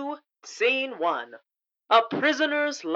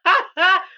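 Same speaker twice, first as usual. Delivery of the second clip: laughing; 10 semitones higher, 5 dB louder than usual. Real, not acted. Laughing at own line.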